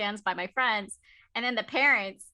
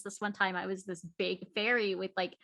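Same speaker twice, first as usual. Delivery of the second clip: ordinary delivery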